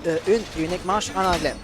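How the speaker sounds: usual speaking voice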